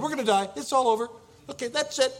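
high, loud burst of panic; acted panic. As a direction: blurt it out like someone caught in an earthquake. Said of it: "you begin to panic".

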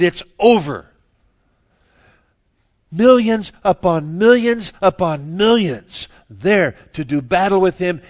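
very loud, high pulpit proclamation; full-force preaching. Acted. Preacher telling how Christ wins the battle by speaking, full force. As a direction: hammer it out, stretching the key words.

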